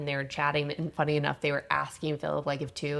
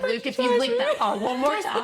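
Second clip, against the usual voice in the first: very high, louder scolding with a laugh; mock scolding; 6 dB louder than usual. Scolding the dog for licking, shrill laughing threat. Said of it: "I was like".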